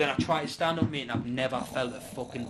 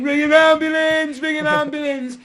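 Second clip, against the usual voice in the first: very high, loud, drawn-out wail; panicked shout; 12 semitones higher, 12 dB louder, slower than usual. Retold or imitated. Man collapsed on floor begging for help, panicked shouting. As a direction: re-enact a desperate cry for help, high and slow. Retold panicked plea after falling.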